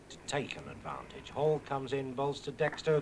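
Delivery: ordinary talk, nothing marked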